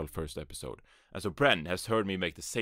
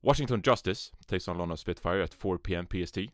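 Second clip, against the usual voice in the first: ordinary talk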